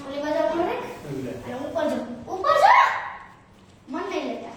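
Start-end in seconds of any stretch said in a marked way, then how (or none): 2.3-2.7 high, loud call to look up; raised-pitch attention-getting call. Real, not acted. Calling child to look up.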